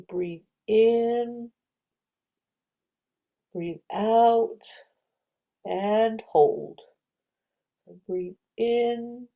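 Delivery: drawn-out, calm breathing cues; slow breathing guide. Shown as a demonstration. Teacher leading children in slow calm breaths. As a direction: stretch each cue like a slow breath. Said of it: "pretend to put your finger on that".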